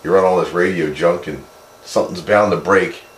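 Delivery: ordinary talk, nothing marked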